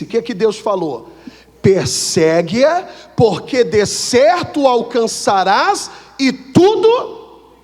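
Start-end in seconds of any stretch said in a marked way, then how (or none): 1.6-7.5 slow, drawn-out pulpit reading of the verse; solemn scripture reading. Acted. Preacher reading the verse to the congregation, slow and solemn. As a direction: read the holy words slowly and grandly. Preacher reads God's answer.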